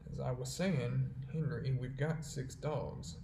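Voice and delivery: slow, deliberate, each word given weight; slow and weighty. Acted. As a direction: lean on every word so it sinks in. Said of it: "his words might gain greater significance".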